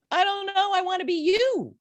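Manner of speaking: retelling a blurted answer to her therapist. Retold or imitated. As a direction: let it burst out before you can stop it. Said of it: "that just blurted out".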